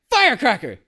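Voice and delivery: high, loud imitation of a screaming man; mock screaming. Host re-enacting the audio clip of the guy, mock screaming. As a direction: holler it as a human firework.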